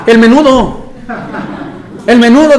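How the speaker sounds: high, loud laughing reveal; real laughter. Real, not acted. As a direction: deliver the punchline laughing. Laughing punchline.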